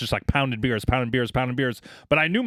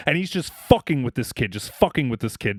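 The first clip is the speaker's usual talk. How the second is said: ordinary talk, nothing marked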